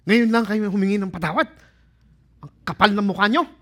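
loud, fast, high indignant snapping; fast, loud Tagalog rebuke; angry retort. Pretend. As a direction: scold them for asking so late. Imagined angry retort to brothers.

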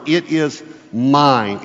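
"It is mine" slow with weight on "It"; "It is mine" stretched out and firm; emphatic it is mine. Shown as a demonstration. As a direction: lean hard on "It", then let "mine" fall. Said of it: "very emphatic phrase".

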